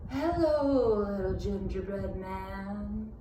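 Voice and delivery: soft, slow sly fox; sly grinning fox. A narrator's reading. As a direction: purr it like a fox with a plan. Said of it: "he grinned".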